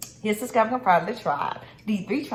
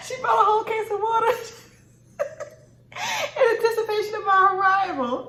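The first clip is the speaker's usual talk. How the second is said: high laughing voice; 13 semitones higher than usual. Real, not acted. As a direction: talk through a giggle. Laughing about sister.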